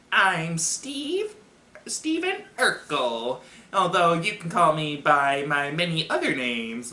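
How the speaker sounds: squeaky, annoying nerd voice; high, drawn-out nerdy introduction; Steve Urkel. Acted. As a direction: put on a squeaky pest's voice. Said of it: "this squeaky, annoying voice".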